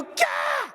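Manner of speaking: sharp high startled yelp. Retold or imitated. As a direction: jump out of your skin. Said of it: "I go".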